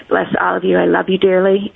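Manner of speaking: usual speaking voice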